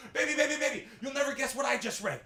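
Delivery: high, eager rushed call to his wife; excited. Retold or imitated. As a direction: burst in thrilled with news. Excited husband running to wife.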